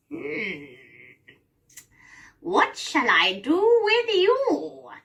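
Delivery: high, slow, drawn-out witch voice; scheming witch. Acted. Witch sizes up Gretel, scheming witch voice. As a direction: draw it out, relishing the threat. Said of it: "she rubs her hands and looks at Gretel".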